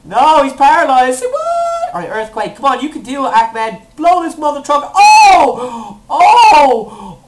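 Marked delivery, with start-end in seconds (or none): 0.0-1.9 loud protest rising to a high squeal; high, loud dismay; outraged disbelief. Real, not acted. Move fails. 3.9-7.2 loud, high shouts; excited gamer yelling; battle yell. Real, not acted. Battle goes wrong.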